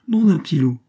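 a loud, high burst of surprise; surprised exclamation. A narrator's reading. A charcoal burner startled to recognise the fainted girl, exclaiming loudly. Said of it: "s'écria le grand Justin".

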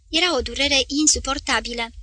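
usual speaking voice

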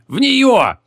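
high, urgent calling out; excited high-pitched shouting; shouted order. A narrator's reading. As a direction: yell it across the room, pitch up. Shouted order and reply under spray.

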